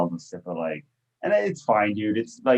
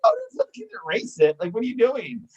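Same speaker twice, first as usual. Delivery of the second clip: exasperated outburst; 10 semitones higher than usual. Retold or imitated. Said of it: "I was like".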